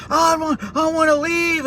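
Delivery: high, frantic imitation of the kid; panicked plea. Panicked kid in the gas chamber pleading, voice high. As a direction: mimic a scared kid begging to get out. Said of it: "he's yelling for his mom".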